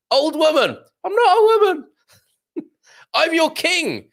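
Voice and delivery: high, loud comic character squawk; hammed-up high-pitched sketch voices; badly done Monty Python bickering. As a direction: act out a silly king-and-peasant argument in falsetto. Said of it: "if I've done that very badly".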